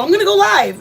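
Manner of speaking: sudden high, loud burst; excited announcement. Retold or imitated. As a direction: blurt it out like thrilling news. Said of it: "I go".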